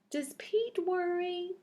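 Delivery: ordinary delivery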